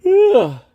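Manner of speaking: loud, high real yawn. Real, not acted. Livestreamer between remarks to viewers, yawning. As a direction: let out a big noisy yawn. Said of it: "Boy, I'm yawning".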